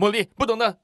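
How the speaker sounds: put-on harsh yelling at child. Shown as a demonstration. Father demonstrating the harsh way he does not scold, loud yell. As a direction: snap at the kid like you've lost patience. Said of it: "那这是两种不一样的情绪".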